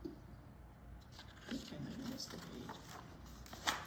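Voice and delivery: plain reading voice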